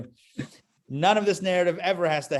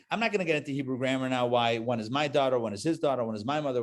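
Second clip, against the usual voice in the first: ordinary talk, nothing marked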